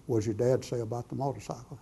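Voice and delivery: soft, lightly voiced Holy Spirit; hushed, unhurried retold question; plain-spoken. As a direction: repeat the question quietly. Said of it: "Holy Spirit says".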